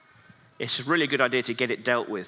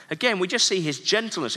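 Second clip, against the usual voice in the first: usual speaking voice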